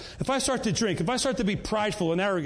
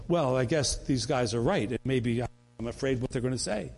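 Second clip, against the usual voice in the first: hesitant Peter; 9 semitones lower than usual. Retold or imitated. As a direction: voice Peter giving in, half afraid. Peter voiced, wavering from fear.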